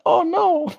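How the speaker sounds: very high, whimpering mock panic. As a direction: squeal it out as if scared the bird won't go back. Panicking about bird.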